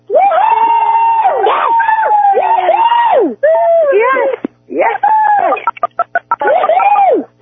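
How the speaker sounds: high, loud whoops of delight; joyful screaming. Real, not acted. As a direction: cheer like the goal just happened. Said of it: "go ahead, scream along".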